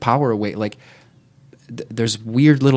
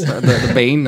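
laughing warm-up; 3 semitones higher, 6 dB louder than usual. Pretend. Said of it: "Can you do it in the accent?".

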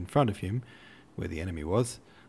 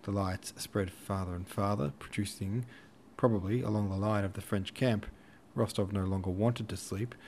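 ordinary delivery, nothing marked

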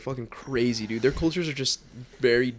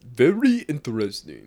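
mock Japanese accent, louder; 6 dB louder than usual. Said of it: "do a Japanese accent".

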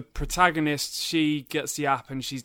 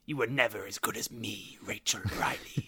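soft, low laughing villain taunt; quiet villain taunt; 5 semitones lower, 7 dB quieter than usual. Acted. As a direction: gloat over a captive. Voicing Carol Vorderman taunting.

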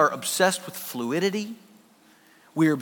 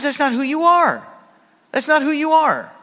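higher, louder, insistent repeat; impassioned preaching; 9 semitones higher, 4 dB louder than usual. Acted. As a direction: hammer the point home to the congregation. Preaching peak.

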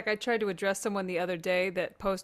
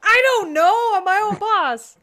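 mock whiny defense; 10 semitones higher, 9 dB louder, slower than usual. Retold argument with wife.

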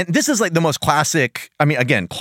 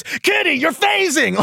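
high-pitched mock scolding of Kitty; hammed-up alarmed cry; mock yelling; 14 semitones higher, slower than usual. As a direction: imitate an exasperated teacher yelling. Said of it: "he so easily yells at her".

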